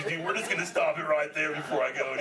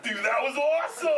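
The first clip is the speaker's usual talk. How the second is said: excited; 11 semitones higher, 5 dB louder than usual. Acted. Toy flies, big reaction.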